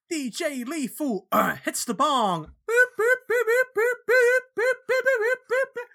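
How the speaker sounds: loud, high-pitched boops; high boop sound effects. Retold or imitated. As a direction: imitate a sampler going boop. Imitating the band's bong hit sound effect.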